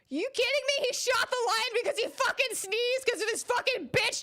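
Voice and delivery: high-pitched, loud angry rant; outraged shouting. Real, not acted. Gamer learns why the lion was shot, outraged shouting. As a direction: yell in furious disbelief.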